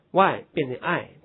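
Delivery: ordinary delivery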